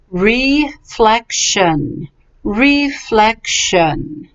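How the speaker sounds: "Reflection" very slowly, each syllable stretched; pronunciation model. Shown as a demonstration. Teacher repeats for student, slow careful pronunciation. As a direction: stretch out "Reflection" as re, flec, tion. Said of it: "let's do the pronunciation".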